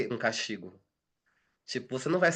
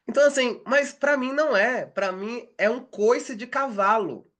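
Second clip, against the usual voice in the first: emphatic protest; 9 semitones higher, 3 dB louder than usual. Real, not acted. Insists it hurts her.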